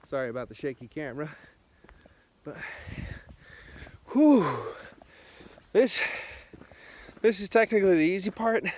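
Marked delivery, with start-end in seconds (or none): 4.1-4.5 loud, high exhale of relief; breathless whew. Real, not acted. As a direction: blow out a tired whew. Exhale after climbing hill.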